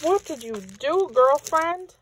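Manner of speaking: high, rising amazement at a friend's gift; happily astonished; delighted disbelief. Real, not acted.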